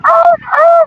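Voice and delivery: very high wailing "ah, ah"; two high-pitched mimicked cries; imitated noise. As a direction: mimic a high, distant wail. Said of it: "it's like".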